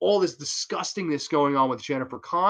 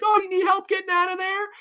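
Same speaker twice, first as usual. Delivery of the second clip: much higher, mocking sing-song offer of help; taunting. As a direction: taunt her from above the pit. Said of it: "totally taunting her".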